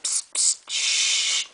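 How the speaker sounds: unvoiced hissing "psst psst shh" like a tap; imitating a sink noise. Shown as a demonstration. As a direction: hiss it out like spraying water. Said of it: "It doesn't make that noise".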